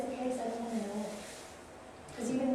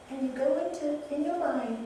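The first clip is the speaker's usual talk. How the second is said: usual speaking voice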